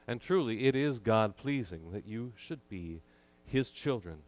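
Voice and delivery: ordinary delivery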